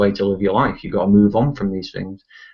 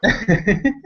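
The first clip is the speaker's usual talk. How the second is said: high laugh; 10 semitones higher than usual. Real, not acted. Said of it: "sounds like something a psychopath would say".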